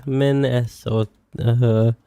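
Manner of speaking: slow sounding out. Real, not acted. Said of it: "I feel like I've been lobotomized".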